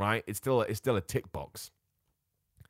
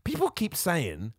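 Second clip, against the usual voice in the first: ordinary talk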